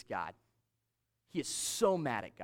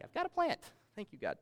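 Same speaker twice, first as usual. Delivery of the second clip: mock happy Jonah; 8 semitones higher than usual. Pastor voices happy Jonah.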